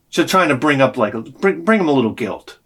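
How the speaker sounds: ordinary talk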